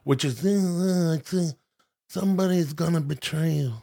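soft, mumbled Godfather voice; a hint of the Godfather rasp; Marlon Brando. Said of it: "Marlon Brando".

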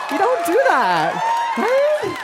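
emphatic. Real, not acted. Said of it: "emphatic high aside".

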